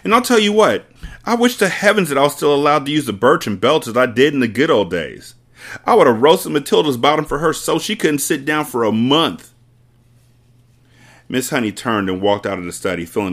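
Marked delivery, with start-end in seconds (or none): 0.0-9.5 higher, louder gleeful threat; ranting headmistress. Acted. Headmistress longing for the cane, loud and gleeful. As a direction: relish the cruelty. Trunchbull ranting about punishment.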